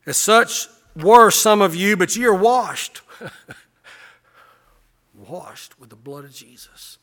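loud, high, fervent pulpit preaching; a revival preacher at full cry; preaching at full force. Acted. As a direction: preach it out and lift them up. Pastor preaching to congregation.

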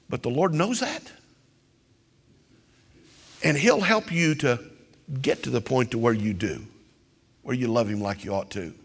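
ordinary delivery

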